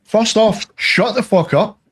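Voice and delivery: high, exasperated retort; indignant storyteller's outburst; retold angry comeback. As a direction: re-enact telling someone off, pitched well up.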